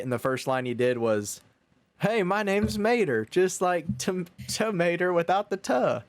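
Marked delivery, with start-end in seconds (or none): 2.0-6.0 Mater voice. Retold or imitated. Retelling his first line as the tow truck Mater. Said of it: "just talk like you".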